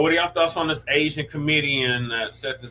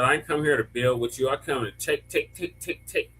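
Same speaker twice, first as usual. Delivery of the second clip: quietly mocking African accent; 4 dB quieter than usual. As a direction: mimic a Ugandan woman's African accent. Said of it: "Hey, [name], do that accent. I like the accent".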